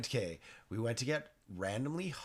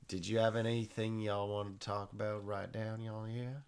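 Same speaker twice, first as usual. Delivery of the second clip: southern drawl. Acted. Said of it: "I wanna have a lot more southern drawl".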